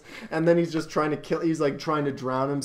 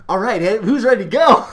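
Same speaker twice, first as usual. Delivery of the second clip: loud, high, hyped-up imitation of a guide; rallying shout; 7 semitones higher, 9 dB louder than usual. Just-dumped guide turning back to the rafters, loud pep. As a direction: call the crew to action, loud and high.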